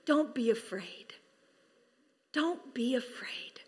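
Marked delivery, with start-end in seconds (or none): 2.3-3.6 slow soft whisper; whispered reassurance. Acted. Angel whispering comfort to the shepherds, slow whisper. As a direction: breathe it gently, slowly, unvoiced.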